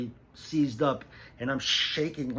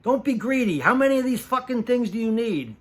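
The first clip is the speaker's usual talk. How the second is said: loud, high protest cutting the takes short; retelling a costar's loud outburst on set; mock yell; 10 semitones higher, 9 dB louder than usual. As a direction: shout the other actor down to stop the fight. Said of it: "Ray goes".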